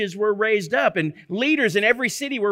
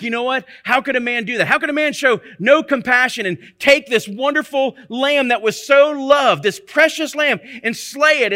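loud, higher, indignant outcry; rising indignant protest; incensed outburst; 4 semitones higher, 5 dB louder than usual. Retold or imitated. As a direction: rail at the injustice like an outraged king. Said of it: "King David just got incensed".

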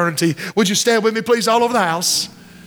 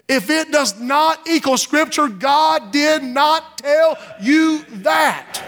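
higher, slow, shouted rebuke; forceful rebuke; 6 semitones higher, much slower than usual. Real, not acted. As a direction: hammer each word home. Preacher rebuking self-deception.

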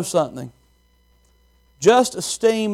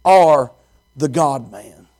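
reverent confession; 6 semitones lower, much slower than usual. Acted. Peter confessing to Jesus, low and reverent. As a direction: breathe it out in reverence. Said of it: "He says".

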